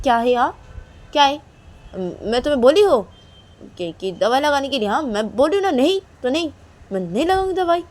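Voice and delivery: loud, scolding, indignant; angry refusal. Acted. Angry Reshma scolding her friend Kajal. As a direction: snap back at her for touching the suit.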